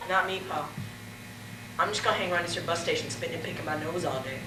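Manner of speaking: soft, fast mumbled reply; quiet mumble. Acted. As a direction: mumble it lazily under your breath. Son answers quietly.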